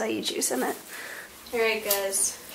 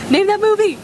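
excited call; 9 semitones higher, 6 dB louder, faster than usual. Real, not acted. Quiz after movie quote.

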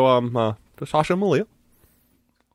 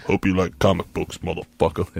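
cowboy voice; 7 semitones lower than usual. Acted. Host redoing his cowboy greeting to listeners, much lower. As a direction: growl it like a rough cowboy. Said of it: "cowboy voice".

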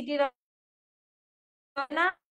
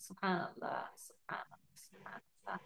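breathless reverent whisper, trailing away; whispered subhanallah; 5 semitones lower, 15 dB quieter than usual. Real, not acted. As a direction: breathe it out in awe, barely audible. Soft devotional exclamation. (ফিসফিস করে ভক্তিভরে সুবহানাল্লাহ)

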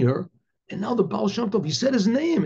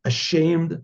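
ordinary delivery

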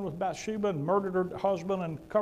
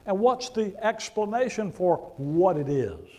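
ordinary delivery

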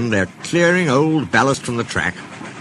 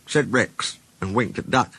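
ordinary delivery

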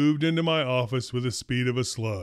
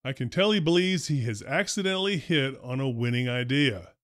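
usual speaking voice

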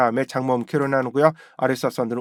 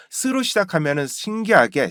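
normal talking voice

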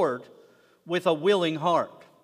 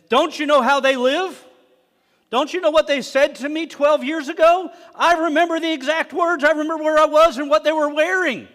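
louder and higher, a mocking grudge rant; building mock rant; 8 semitones higher, 8 dB louder than usual. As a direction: swell up like a petty grudge-holder.

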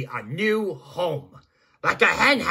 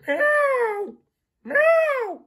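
much higher, sharp kitten meows; cat meowing. Acted. Fluffy the cat calling to the builder with high meows. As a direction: cry out like an eager little cat.

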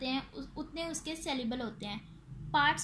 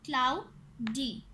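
"Cloudy" slowly in two beats; "cloudy" split for counting; syllable by syllable; 2 semitones lower, 3 dB louder, much slower than usual. Shown as a demonstration. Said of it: "इसके टू syllables हैं".

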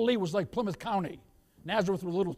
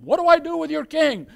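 high, loud appeal to the crowd; acted Pontius Pilate; 10 semitones higher, 11 dB louder than usual. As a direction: call the question out to a mob. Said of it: "they shouted to Pontius Pilate".

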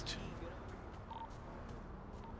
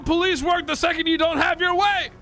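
drill sergeant questioning; 6 semitones higher, 3 dB louder than usual. Acted. Mock military oath questions.

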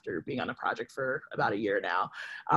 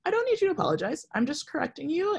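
much higher, fast quoted retort; quoting herself; 13 semitones higher, faster than usual. Retold or imitated. Retelling what she told her apologizing manager, high quick retort. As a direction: toss it off quickly, pitched way up. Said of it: "And I was like".

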